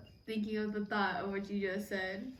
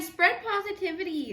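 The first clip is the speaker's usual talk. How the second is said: mock preachy; 6 semitones higher, 5 dB louder than usual. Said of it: "he was saying".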